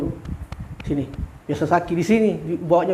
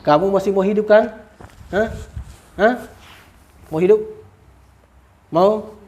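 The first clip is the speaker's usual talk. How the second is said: threatening; 5 semitones higher than usual. Real, not acted. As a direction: press the spirit hard. Threatening the spirit.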